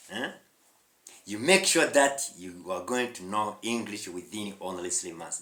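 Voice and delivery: ordinary talk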